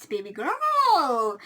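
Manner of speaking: slow, drawn-out pointed question; drawn-out knowing tease. Retold or imitated. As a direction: stretch it out, knowingly. Teasing friend about boyfriend.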